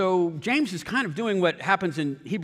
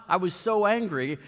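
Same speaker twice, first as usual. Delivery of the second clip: ordinary talk, nothing marked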